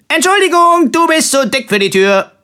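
high, loud cheery shout; cheerful call. A narrator's reading. Simpel calling down to the lady, cheerful shout. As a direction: toss it back gleefully from the stairs. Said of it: "rief fröhlich".

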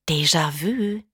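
a hint of a hissing inner voice. A narrator's reading. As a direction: lightly hiss it like a thought. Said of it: "zischte die Stimme in ihrem Kopf". (leicht zischende innere Stimme)

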